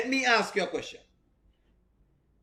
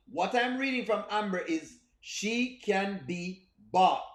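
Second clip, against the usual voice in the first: slow and deliberate, hammering it home; slow emphatic repeat; slower than usual. Shown as a demonstration. Streamer repeating his verdict on Amber, slowly. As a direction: let each word land slowly. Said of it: "slowed emphatic repeat".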